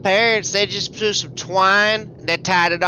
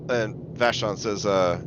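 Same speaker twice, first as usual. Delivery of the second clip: normal talking voice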